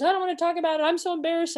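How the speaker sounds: high-pitched mock distress, no real sobs; imitated upset teen. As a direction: imitate a daughter too upset to talk. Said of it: "she's sobbing".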